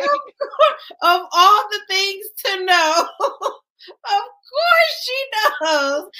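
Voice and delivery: high, loud laugh-crying; howling with laughter; real laughing fit. Real, not acted.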